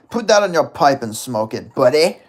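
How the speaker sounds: loud, punchy put-on catchphrase; attempted impression. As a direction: punch it out loudly, hardest on the last word.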